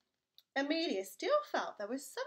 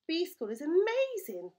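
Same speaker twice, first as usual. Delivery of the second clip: delighted; 6 semitones higher, 7 dB louder than usual. Acted. Norman delighted by bee school.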